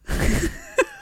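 a high burst of laughter, cracking up; high squealing laugh. Real, not acted. Telling a podcast friend about the pickup, high squealing laugh. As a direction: let a shrill laugh burst out.

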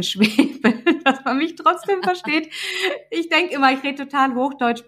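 high-pitched, talking through laughter; laughing. Real, not acted. Guest apologizing for her Swabian dialect, laughing. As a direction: laugh while you say it, pitch climbing.

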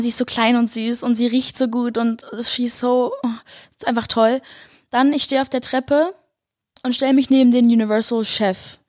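ordinary delivery

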